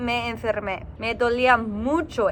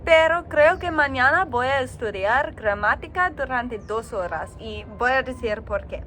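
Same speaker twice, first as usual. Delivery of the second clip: usual speaking voice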